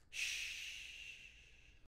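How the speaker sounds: long, soft voiceless shh. Acted. Jalen showing his sword from the scabbard, soft shh. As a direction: let out a quiet, drawn-out shh. Character imitates a sound, voiceless.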